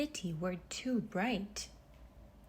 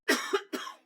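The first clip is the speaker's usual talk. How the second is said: a short high cough, as if choking on smoke; 10 semitones higher, 3 dB louder than usual. Acted. Narrator coughing at the smoky city air. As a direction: cough once like dusty air caught your throat.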